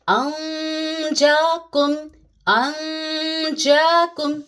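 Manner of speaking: अंजाकुम chanted slowly, the nasal held without touching the tongue; ikhfa nasal hold. Shown as a demonstration. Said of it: "जबान को खींच के रखेंगे".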